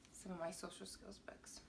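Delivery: soft secret whisper. Acted. Whispering the surprise to her young viewers. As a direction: let it out in a hush.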